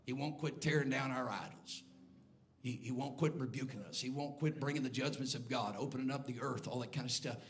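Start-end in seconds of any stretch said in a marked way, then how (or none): none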